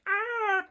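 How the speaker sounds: very high, short yelp; imitated startled shriek; mock scream. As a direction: squeal as if someone just loomed over you. Imitates scream at boy leaning over.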